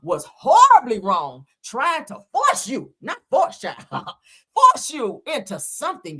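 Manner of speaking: hammed-up preacher apology; mock pastor voice. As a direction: drag it out like a guilty preacher.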